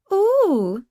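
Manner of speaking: put-on high, loud girlish coo; baby girl voice. A narrator's reading. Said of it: "puts on a baby girl voice".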